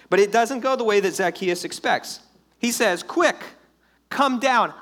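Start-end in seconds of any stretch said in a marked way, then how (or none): 3.0-4.7 high, loud call up to someone; urgent call. Acted. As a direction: call them down, raised and punchy. Jesus calls Zacchaeus down.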